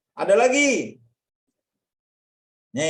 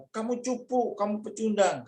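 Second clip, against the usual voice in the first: mocking insult; 9 semitones higher than usual. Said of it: "Ketika orang bilang".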